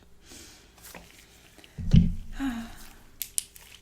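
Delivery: long, voiceless exhale; breathy sigh. Acted. Nurse after recalling watching people pass, long breathy sigh. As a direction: let out a drawn-out breathy sigh.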